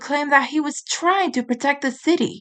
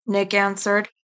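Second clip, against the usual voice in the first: plain reading voice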